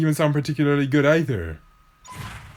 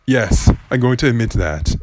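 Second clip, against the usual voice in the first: ordinary talk, nothing marked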